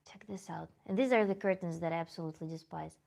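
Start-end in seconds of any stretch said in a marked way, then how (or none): 0.1-0.7 hushed whisper. Real, not acted. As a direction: whisper so the spider stays put. Shows spider on camera.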